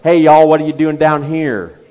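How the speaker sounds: loud, hammed-up southern accent calling out; a comic southern y'all voice, called loudly; southern drawl. Preacher jokes about accents with a loud southern accent. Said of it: "it's Peter's accent that gives him away".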